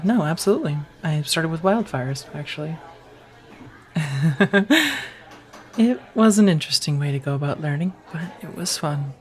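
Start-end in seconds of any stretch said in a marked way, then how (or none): 3.9-5.1 short laugh. Acted. Laughs about starting with wildfires.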